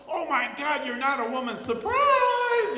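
high, loud shocked cry of surprise; mock surprise. Tricked date shrieking in mock surprise. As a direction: play the shocked date, voice jumping up.

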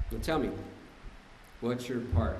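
low, soft, measured probing; calm retold question. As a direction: imitate a partner patiently asking. Partner calmly questions ranting man.

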